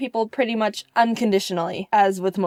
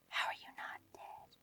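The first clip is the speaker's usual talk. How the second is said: hushed disbelieving whisper; 20 dB quieter than usual. Retold or imitated. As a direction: breathe it in shock. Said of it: "I was like".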